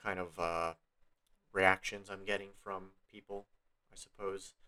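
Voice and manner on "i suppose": ordinary delivery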